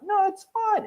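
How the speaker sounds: high, sing-song mock "it's fine"; smooth it over while lying; fake reassurance. Two-faced lawyer reassuring the other side, falsely sweet. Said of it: "But to your face".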